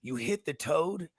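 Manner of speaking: hushed secretive whisper; breathy stoner whisper. Pretend.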